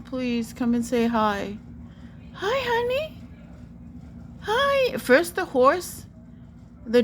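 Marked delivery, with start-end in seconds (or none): 2.3-3.2 high, rising coo; sweet cooing. Real, not acted. Greeting the bird that came right here, cooing. 4.4-5.0 high, rising coo; sweet cooing. Real, not acted. Greeting the bird that came right here, cooing.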